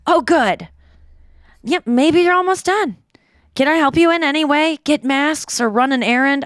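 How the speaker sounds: light sketch of a doting mother offering help; Julie. Retold or imitated. Daughter reads mom's texts aloud in a lightly voiced eager mom. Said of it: "Can you do it as Julie?".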